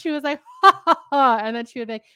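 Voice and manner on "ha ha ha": loud, raised, put-on ha-ha laugh; tried-out fake laugh. Woman imitating her third-grade friend trying new laughs, fake ha-ha. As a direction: imitate a child rehearsing a big laugh. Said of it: "She was trying out new laughs".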